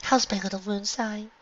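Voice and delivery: soft, tender mother; sincere. A narrator's reading. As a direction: ask it tenderly. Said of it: "in her sincere voice".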